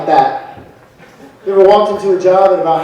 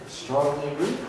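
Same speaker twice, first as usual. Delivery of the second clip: deadpan answer; 4 semitones lower, 13 dB quieter than usual. Retold or imitated.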